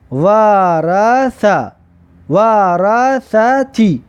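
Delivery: very slow, drawn-out syllables; slow teacher's model reading. Shown as a demonstration. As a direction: stretch each syllable for learners to copy.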